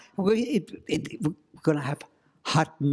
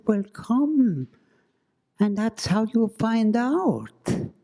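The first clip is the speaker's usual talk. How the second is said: coaxing ghost voice; 10 semitones higher, 3 dB louder, slower than usual. Acted. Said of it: "The woman said".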